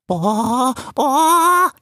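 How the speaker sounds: frightened groan. A narrator's reading. Vulture Erfan scared of flying, fearful groans. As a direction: groan in fear of heights.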